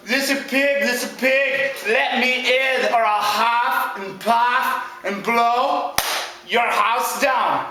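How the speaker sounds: slow, deliberate villain demand; drawn-out storybook wolf; threatening big bad wolf. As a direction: stretch out each threat with menace.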